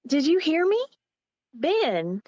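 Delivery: loud, high-pitched demand; calling after him. Acted. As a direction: call after someone walking away. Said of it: "still talking to him".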